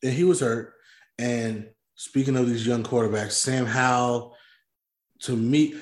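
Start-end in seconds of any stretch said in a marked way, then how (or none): none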